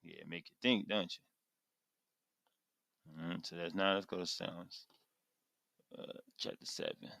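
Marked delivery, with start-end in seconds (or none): none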